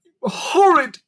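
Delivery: put-on high, loud sobbing girl. A narrator's reading. Lucy crying at the creakers, high and loud through sobs. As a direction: wail it out through tears. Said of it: "Lucy sobbed".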